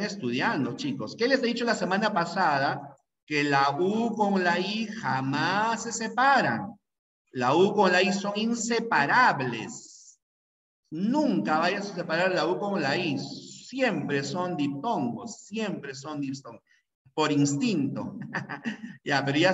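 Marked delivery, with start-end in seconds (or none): 3.3-10.2 slow, insistent classroom rule; slow emphatic rule. Shown as a demonstration. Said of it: "teacher drilling the rule". 13.2-16.6 Siempre son diptongos stretched out slowly; slow, insistent repetition; very slow insistence. Shown as a demonstration. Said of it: "teacher repeating the rule".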